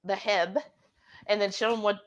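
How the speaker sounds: usual speaking voice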